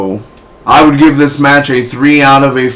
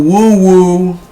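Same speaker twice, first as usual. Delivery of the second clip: softer woo; 8 semitones higher, 3 dB louder than usual. Retold or imitated. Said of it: "it's more like a woo".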